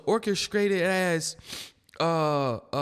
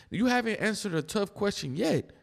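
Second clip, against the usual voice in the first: usual speaking voice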